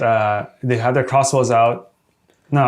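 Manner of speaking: normal talking voice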